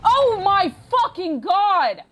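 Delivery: drawn-out pained cry, loud and high; anguished yell. Real, not acted. Player cursing the game, slow anguished shout. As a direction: gamer losing it at the tank, crying out. Frustrated gamer outburst.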